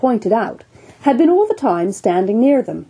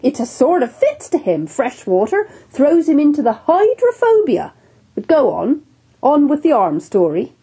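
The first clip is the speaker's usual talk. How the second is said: jovial captain; 6 semitones higher than usual. Acted. Captain jokes about surgeon.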